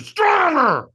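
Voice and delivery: very high, loud coach's scream; screamed command. Retold or imitated. Trainer in a firewalker's face, screaming. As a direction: scream it right into their face. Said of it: "screamed at me".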